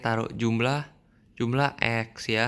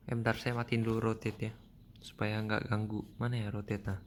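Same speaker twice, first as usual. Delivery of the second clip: low-volume mumble to oneself; muttered aside; 2 semitones lower, 7 dB quieter than usual. Real, not acted. Teacher fiddling with screen rotation mid-lesson, muttered aside. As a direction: say it softly, half to yourself.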